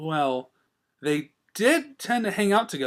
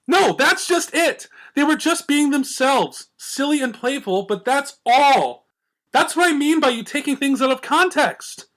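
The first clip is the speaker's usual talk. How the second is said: higher, louder, slow exasperated mare; frustrated Twilight; 11 semitones higher, 5 dB louder than usual. A narrator's reading. Twilight correcting Rarity, high and exasperated. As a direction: plead in exasperation.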